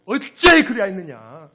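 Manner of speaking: loud, heartfelt shout of reproach; God's anguished cry. Acted. Preacher voicing God's heart to the congregation, anguished shout. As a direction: belt out the question in anguish. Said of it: "하나님이 이 심정을 한번 느껴보세요".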